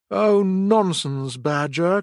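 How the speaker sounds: high, slow protest from Toad; crying out. A narrator's reading. Toad scoffing at Badger, high and drawn out. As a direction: stretch out the nonsense in disbelief. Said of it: "cried, said Toad".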